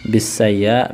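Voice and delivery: normal talking voice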